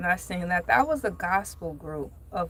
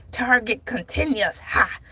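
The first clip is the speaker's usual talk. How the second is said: preacher cadence, punctuated with "ha"; Reverend Archibald Turner. Acted. Host reading Target's plan as a reverend, preacherly cadence. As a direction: read it like a sermon, huffing after each phrase. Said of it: "like Reverend Archibald Turner".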